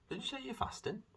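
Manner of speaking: high imitation of mum; mum's voice again. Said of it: "she then said".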